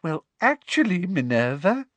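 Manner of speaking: high, sugary lilt rising at the end; simpering. A narrator's reading. Umbridge correcting her colleague Minerva, simpering. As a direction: simper it with false sweetness. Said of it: "simpered Professor Umbridge".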